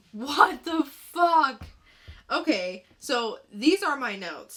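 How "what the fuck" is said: slow, drawn-out baffled what the fuck; baffled outburst. Real, not acted. Co-host reacts to a baffling comic, drawn-out disbelief. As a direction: let the confusion burst out.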